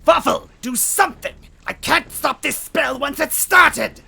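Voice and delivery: desperate witch wailing at her minion; frantic villainess. A narrator's reading. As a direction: yell orders in a panic. Villain shouting at henchman.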